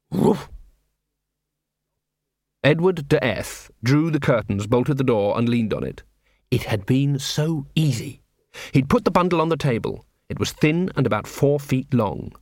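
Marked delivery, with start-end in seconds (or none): none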